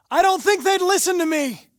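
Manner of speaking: high, loud protest shouted over noise; calling out. Acted. Android shouting back over the noise, loud protest. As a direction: raise your voice to be heard.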